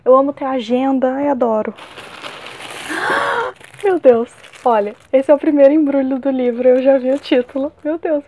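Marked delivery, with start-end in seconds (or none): 1.8-3.5 long, high intake of breath; amazed gasp. Real, not acted. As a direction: gasp in real astonishment. Sees a wished-for book appear.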